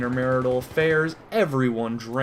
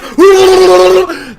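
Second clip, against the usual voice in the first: long, high, very loud held yell; wild wordless yell; 17 dB louder than usual. Pretend. Comic follows a mock knife alarm, wild held yell.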